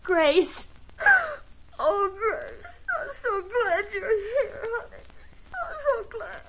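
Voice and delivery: high, tearful sobbing greeting; cling to her sister, crying; sobbing relief. Acted. Sister arrives after husband beaten.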